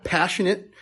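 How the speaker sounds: normal talking voice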